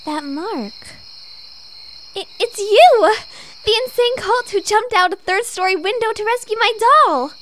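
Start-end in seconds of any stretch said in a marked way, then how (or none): 2.1-7.3 loud, higher gasp of delight; star-struck fan spotting her hero; thrilled recognition. Acted. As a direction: burst out with excited disbelief.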